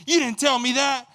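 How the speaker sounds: high, loud angry denial; mad border guard. Retold or imitated. Guard denying what the traveler told him, mock-angry shout. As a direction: shout back as the indignant guard. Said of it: "that's when he got mad".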